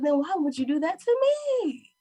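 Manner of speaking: high-pitched, pleading retort; retold exasperated challenge. Girlfriend challenges boyfriend over correcting her, exasperated. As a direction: push it up high, as if baffled by him.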